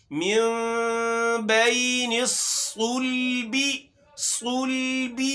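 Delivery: slow recitation of صلب with the kasra under با fully voiced; clear kasra on ba. Shown as a demonstration. Teacher shows children how to read صلب, slow chant. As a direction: sound out صلب slowly, giving its زیر in full. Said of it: "با کے نیچے زیر کی حرکت ہے".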